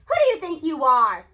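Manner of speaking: loud indignant grandmother; storming. A narrator's reading. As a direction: tear into him, furious and loud. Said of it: "she storms at the stunned Doctor Thaddeus".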